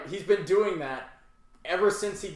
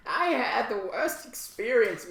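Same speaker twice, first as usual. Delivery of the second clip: slow, higher-pitched whiny reviewer; put-on Yelp complaint voice; mock complaining customer; 5 semitones higher, slower than usual. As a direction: drag it out like an aggrieved customer. Voicing the woman's imagined Yelp review.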